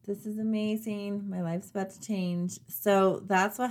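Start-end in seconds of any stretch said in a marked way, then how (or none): 0.0-2.6 awed delight. Retold or imitated. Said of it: "you know that moment where you're like".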